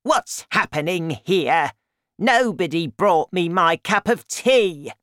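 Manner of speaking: loud, put-on grumbling granny; Grandma, demanding. A narrator's reading. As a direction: cry out a complaint as the grandmother. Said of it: "and she cried".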